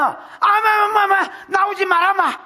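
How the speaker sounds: acted angry shout, pitched high; harsh outburst. Preacher playing God fed up with unbelievers, harsh acted shout. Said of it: "반응이 좀 심했나".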